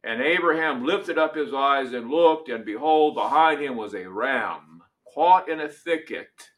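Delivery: measured, solemn reading of Scripture; dramatic Bible reading from the pulpit. Acted. Reads Genesis 22 aloud.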